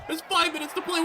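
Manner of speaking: normal talking voice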